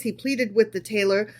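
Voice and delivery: plain reading voice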